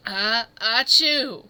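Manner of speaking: a big drawn-out sneeze; loud sneeze. Real, not acted. A loud sneeze giving away the hiding spot. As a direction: let a loud sneeze burst out.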